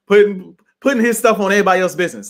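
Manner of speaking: fast, loud, laughing through the scolding. Retold or imitated. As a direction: laugh it out while telling him off. Laughing mid scolding quote.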